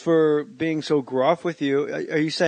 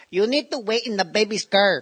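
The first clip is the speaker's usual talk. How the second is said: higher mock Mexican nanny accent; 6 semitones higher than usual. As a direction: send him out to wait, in a Mexican nanny accent. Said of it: "I can't do an impression that good".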